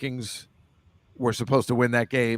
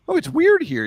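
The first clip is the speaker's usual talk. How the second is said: mock high voice; 6 dB louder than usual. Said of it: "all these factors that seem like".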